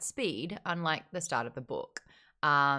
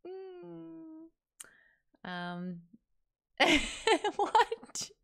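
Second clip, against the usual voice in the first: high, bemused what; mock bewilderment; 11 semitones higher, much slower than usual. Real, not acted. Reacting to the narrator's choices, high and baffled. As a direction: feign baffled disbelief.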